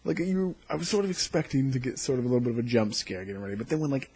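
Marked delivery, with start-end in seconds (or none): none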